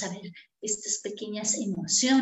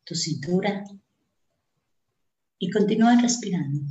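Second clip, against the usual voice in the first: guided meditation; 3 semitones lower than usual. Acted. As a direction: lead them gently into calm breathing.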